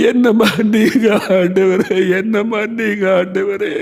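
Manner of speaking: high, tearful crying out to God; weeping prayer. Acted. Preacher crying out to God for his people, weeping and pleading. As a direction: pour out a broken, sobbing plea for mercy.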